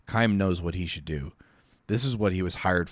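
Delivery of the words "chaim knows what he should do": ordinary delivery, nothing marked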